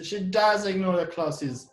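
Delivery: unhappy emphasis on does; stress on does. Shown as a demonstration. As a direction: punch does, let the rest fall. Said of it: "we are somehow unhappy about that".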